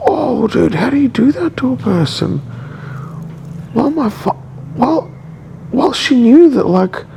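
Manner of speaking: high, cracking voice close to tears; barely holding back tears, voice going up; voice breaking. Real, not acted. Upset about ex, voice cracking.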